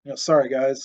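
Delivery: ordinary delivery, nothing marked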